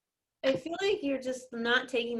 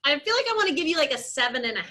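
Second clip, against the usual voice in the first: ordinary delivery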